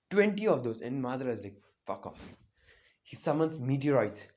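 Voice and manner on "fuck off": very soft, voiceless mutter; Madara's muttered dismissal. Acted. Madara facing twenty giant arms, hushed mutter. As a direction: drop it to a near whisper, fed up.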